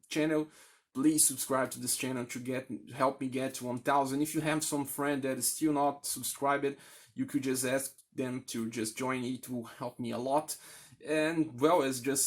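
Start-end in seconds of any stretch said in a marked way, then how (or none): none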